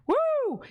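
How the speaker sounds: high-pitched cheering "woo"; celebratory woo. Shown as a demonstration. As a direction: cap the jingle with a high whoop. Ends the donation song demo.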